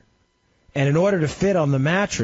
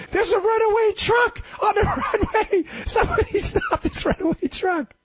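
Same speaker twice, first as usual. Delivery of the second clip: high laughing mock yell of runaway truck; panicked tower shout. Airport tower staff panic over runaway truck, high laughing yell. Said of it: "And they're like".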